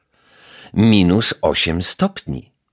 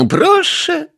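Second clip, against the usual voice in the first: put-on high, loud call of an old lady; 6 dB louder than usual. A narrator's reading. Neighbour calls the detective in, high and loud. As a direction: call "come in" sharply from inside. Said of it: "zawołała pani Majewska".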